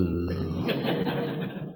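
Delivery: low, soft pleased laugh; soft chuckle. Retold or imitated. Pleased guru after praising disciple, soft chuckle. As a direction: chuckle softly under the breath.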